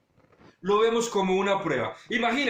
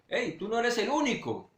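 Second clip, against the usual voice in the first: normal talking voice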